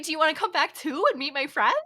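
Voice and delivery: high, fast, excited puppy talk; eager dog voice. Retold or imitated. Dog asking mom to meet friends, high fast eager. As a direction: rush it out like a happy pup. Voicing happy daycare dog.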